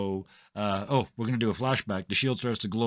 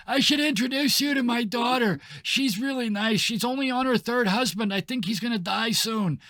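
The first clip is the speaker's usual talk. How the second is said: put-on high chirpy old lady; doting old lady voice; 12 semitones higher, 4 dB louder than usual.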